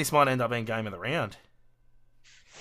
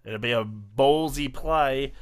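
ordinary delivery

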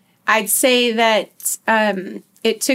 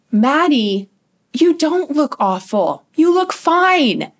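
higher, insistent reassurance; imitated reassuring mom; 7 semitones higher, 4 dB louder than usual. Mom tries to convince her crying daughter, imitated insistent reassurance. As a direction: stress each reassurance like an anxious parent.

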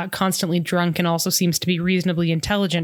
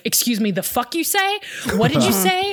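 higher, rising indignant challenge; mock indignant; 9 semitones higher than usual. Ian challenging the militia's remark, mock indignant. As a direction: act out an affronted comeback, pitched up.

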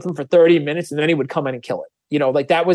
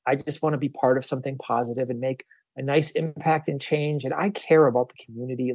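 much softer, gentle do-gooder voice; soft compassionate type; 2 semitones lower, 7 dB quieter than usual. Retold or imitated. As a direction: hush it down like a timid kind soul. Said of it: "soft type of people".

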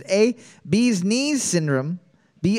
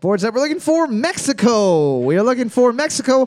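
raised, emphatic quiz-host reveal; announcer call-out; 5 semitones higher, 4 dB louder than usual. Real, not acted. Host revealing the answer to trivia teams, announcer call-out. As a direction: call the answer out to the whole room.

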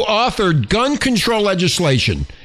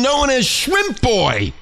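normal talking voice

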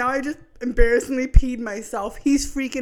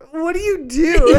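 high, loud retold demand; mock alarm; 6 semitones higher, 8 dB louder than usual. Wife confronting husband amid the mess, mock alarm. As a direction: raise voice and pitch in mock disbelief.

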